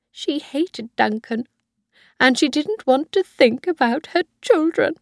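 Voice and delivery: put-on a high old woman pleading with her son; old Mrs Harvey.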